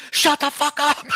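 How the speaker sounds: high-pitched comic yell; mock yell. Shown as a demonstration. Co-host demonstrating how the show ends, high mock yell. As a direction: yell it as the show's stop signal. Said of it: "because I yell".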